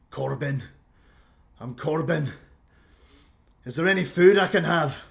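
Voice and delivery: dwarf demanding food from the host; eager dwarf. Acted. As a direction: blurt it out, hungry. Dwarf names himself, asks food.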